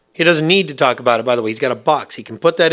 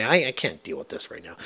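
quiet despair; 4 semitones lower, 12 dB quieter than usual. Retold or imitated. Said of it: "and you say".

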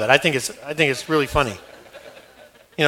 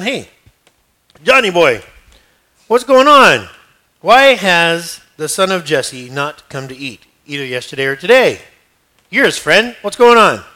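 loud, pushy king's demand; Saul demanding; 5 dB louder than usual. Acted. King Saul pressing his son Jonathan, loud and suspicious. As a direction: lean on him, suspicious and loud. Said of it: "So Saul says to Jonathan".

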